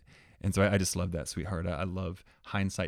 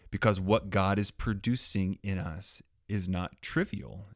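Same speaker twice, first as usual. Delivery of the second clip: ordinary delivery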